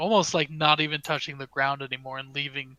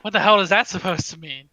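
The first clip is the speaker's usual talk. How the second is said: quick, loud, affronted comeback; fast and raised, taking offence; indignant retort; 4 semitones higher, 9 dB louder, faster than usual. Acted. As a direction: fire it back, offended. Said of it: "and she goes".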